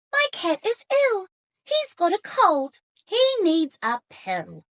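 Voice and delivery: put-on high girl's voice, fretting; a worried child fussing over her cat; Katy the girl. A narrator's reading. Storyteller voicing Katy for children, high and anxious. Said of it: "said Katy".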